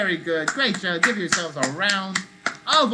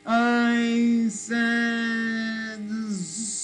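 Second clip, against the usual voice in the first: very slow call; 3 semitones higher, much slower than usual. Shown as a demonstration. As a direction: stretch every sound out, long and drawn. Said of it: "Or I might go really very slow".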